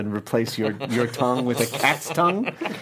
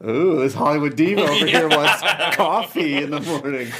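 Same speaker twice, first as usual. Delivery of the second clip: higher, amused mock scorn; 4 semitones higher, 4 dB louder than usual. Crew member retelling a coffee request, mocking the diva. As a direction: sneer at the diva, half laughing. Mocks actor asking for coffee.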